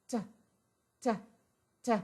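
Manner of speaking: crisp "T", a sharp tap of the tongue; T sound. Shown as a demonstration. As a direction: tap out "T" three times, clearly. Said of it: "pronounce it as".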